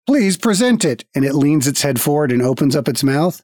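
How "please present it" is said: guild automaton. Acted. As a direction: speak like a polite machine at a desk.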